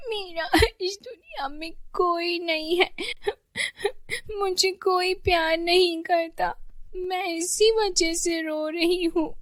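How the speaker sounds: high-pitched crying little girl, acted; whiny child's wail, drawn out at the end; tearful wailing. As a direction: sob the words out like a lonely child. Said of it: "रोते-रोते Pihu परी से कहा".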